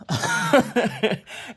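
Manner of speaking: high burst of laughter; helpless laugh at her own ordeal. Real, not acted. Laughs after recalling the test.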